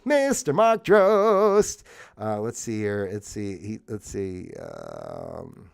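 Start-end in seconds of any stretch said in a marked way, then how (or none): 0.0-1.8 mock announcer. Repeated grand introduction.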